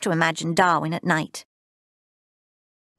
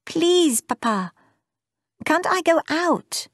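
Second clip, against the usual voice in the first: put-on pleading girl; 9 semitones higher, slower than usual. A narrator's reading. Girl pleading with her father.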